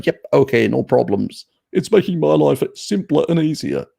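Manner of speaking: high, loud, carefree and gullible; mock naive sheep. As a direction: play it like a clueless follower who happily agrees. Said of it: "most of the sheep will say".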